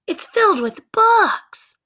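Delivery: high, loud, slow delighted cry; thrilled cry. Acted. Sister crying out from treehouse, high slow loud delight. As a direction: shout the wonder down to him.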